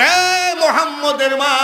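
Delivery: high-pitched hail; calling out. Retold or imitated. Priest hails Mohammad's mother, calling out high.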